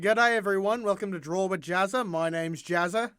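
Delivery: Australian YouTuber impression; lively Australian video-host intro; Jazza. Host welcoming viewers to an art show in an Australian accent. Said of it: "I love Jazza".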